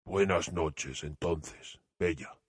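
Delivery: a heartbroken monster saying goodbye; sad. Acted. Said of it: "le dijo tristemente".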